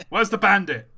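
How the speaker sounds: loud commanding shout; barking soldier. Acted. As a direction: bark it like a raiding officer. Acted raid, shouting soldier.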